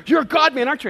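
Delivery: high, booming outburst; loud retold exclamation; imitated yell. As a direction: yell it out like an excited stranger. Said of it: "And he yells out".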